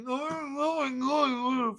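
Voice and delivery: high, slow, whimpering mock sob; hammed-up whiny crybaby voice; mock whiny crying. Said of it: "fucking crying about shit".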